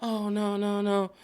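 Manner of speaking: high, quick Oh no no no; eager high protest. Retold or imitated. Cassie texting Nate, protesting in a high voice. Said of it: "she's just".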